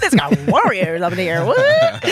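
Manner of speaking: high, loud, laughing wow; amazed excitement. Retold or imitated. Arriving on the Budapest set full of warriors, laughing in awe. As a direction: gush with laughing disbelief.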